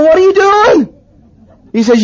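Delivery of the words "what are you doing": high-pitched outraged question; mock disbelief. Man to friend pouring out his beer, mock outrage. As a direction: act out an incredulous protest.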